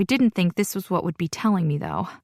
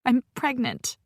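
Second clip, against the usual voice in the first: croaked; 5 semitones higher than usual. Acted. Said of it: "I croak out".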